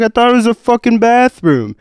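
high, loud exasperated yell; pleading innocence at the top of the voice; retold shouted protest. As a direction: shout your defence in a quarrel. Retold quarrel with bouncer.

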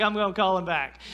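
level, resigned echo of her words; meek surrender. Retold or imitated. Husband echoing his wife's order, meekly giving in. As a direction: give in and repeat her order. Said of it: "I was like".

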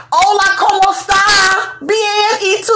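high, hammed-up Spanish greeting; exaggerated high-pitched Spanish small talk; mock Spanish suitor. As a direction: play him sweet-talking in Spanish. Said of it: "Is she Spanish?".

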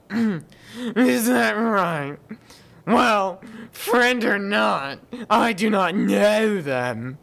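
high, loud, slow royal drawl; pompous king. Acted. King dismissing the party in a hammed-up royal voice. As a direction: lord it over the strangers.

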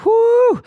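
a loud, high, pumped-up whoo; excited whoop. Pretend. Coach hyping the crowd about morning exercise, loud whoop. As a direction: let out a hype yell like you just finished jumping jacks.

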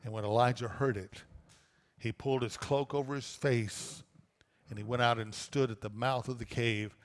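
normal talking voice

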